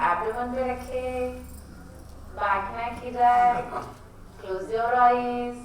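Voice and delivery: drawn out and soft, very slow; slow guiding voice. Shown as a demonstration. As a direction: ease them into the breathing. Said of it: "teacher starts slow guided breathing".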